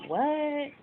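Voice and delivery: high, startled what; surprised what. Retold or imitated. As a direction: pop it up in disbelief. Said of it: "I'm like".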